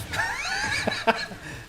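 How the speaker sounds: real squealing laugh; high-pitched laugh. Real, not acted. Storyteller cracking up at his ending, high laugh.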